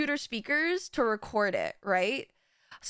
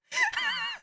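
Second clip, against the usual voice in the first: burst of high laughter. Real, not acted. Laughing at the memory.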